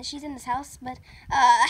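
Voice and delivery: ordinary delivery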